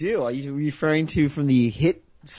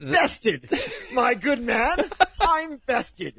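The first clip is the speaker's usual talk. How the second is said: mock gallant loser; 8 semitones higher, much slower than usual. Mock gallant defeated man voice.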